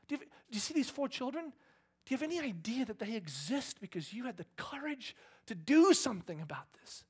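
high, loud, emotional plea; impassioned outpouring. Acted. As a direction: pour it out with rising intensity, voice pitched high. Acted impassioned thanks to imagined girl.

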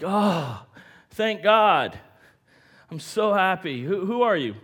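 loud, high gush of relief; hammed-up overjoyed relief, calling upward; acted relief. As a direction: play the rescued man, thrilled and thankful. Acted relief of hanging hiker.

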